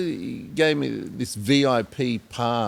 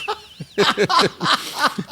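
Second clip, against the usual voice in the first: a big high burst of laughter, retold; imitated laugh; 12 semitones higher, 4 dB louder than usual. Friend retelling how Chris burst out laughing, high laugh.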